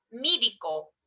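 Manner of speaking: Mídico said with an i in place of the e; mídico for médico. Shown as a demonstration. Teacher demos the Andean mídico. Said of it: "Dice mídico en vez de decir médico".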